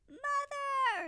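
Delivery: high, loud desperate yell. Acted. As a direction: call down for help while sliding. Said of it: "he yelled".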